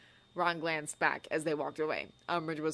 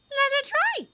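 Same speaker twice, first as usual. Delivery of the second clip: put-on high, loud, fierce girl's voice; defiant rage; 6 dB louder than usual. A narrator's reading. Hermione brushing off Ron's warning, high fierce rage. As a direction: dare her to try. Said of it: "Hermione in a rage".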